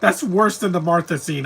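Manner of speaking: ordinary talk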